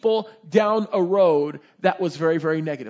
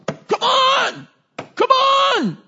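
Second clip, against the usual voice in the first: high-pitched exasperated shouting; frustrated; 7 dB louder, much slower than usual. Acted. Balaam hitting his unmoving donkey, frustrated shouting. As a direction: yell it loud, at your wit's end. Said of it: "So he gets frustrated".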